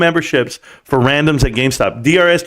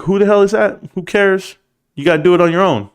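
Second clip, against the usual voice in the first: higher-pitched mock dismissive comedian; George Lopez; 7 semitones higher than usual. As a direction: imitate a dismissive put-down, pitched up. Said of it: "George Lopez was like".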